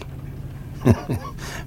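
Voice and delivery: rire soufflé, sans voix; un petit rire las qui s'échappe; rire étouffé. Acted. Rire seul dans le garage. (rire las, presque silencieux)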